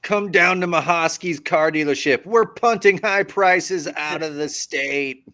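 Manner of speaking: high, loud car dealership pitch; loud hammed-up car lot promo; car dealer ad. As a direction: sell it like a local TV ad. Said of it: "you're amazing at this".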